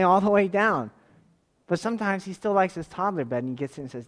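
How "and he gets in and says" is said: ordinary delivery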